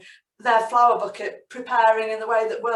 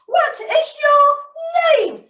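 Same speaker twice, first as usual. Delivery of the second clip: very high, loud squeak; squeaky Flora question; 7 dB louder, much slower than usual. Acted. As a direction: ask in the flower girl's squeaky voice. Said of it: "she said extremely quickly".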